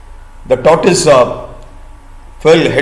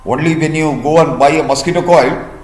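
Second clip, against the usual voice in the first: ordinary delivery, nothing marked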